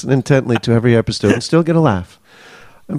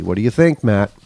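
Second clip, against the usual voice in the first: ordinary delivery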